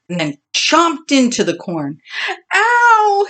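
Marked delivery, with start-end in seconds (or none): 2.5-3.3 sharp, high yelp of pain; cry of pain. Acted. Bully biting hard corn, high yelp of pain. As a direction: yelp as the tooth cracks. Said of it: "he cried out".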